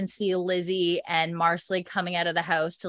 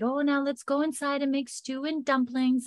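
lightly voiced cheerful old housekeeper, higher; a hint of Mrs Bug; 7 semitones higher than usual. Retelling Mrs Bug's call to make stew, a lightly raised housekeeper voice. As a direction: chirp it like a bustling cook.

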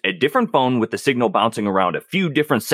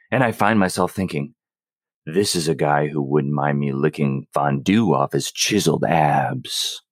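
sultry message reading; 9 semitones lower than usual. Acted. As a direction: drop low and linger on each phrase. Reading a raunchy message aloud.

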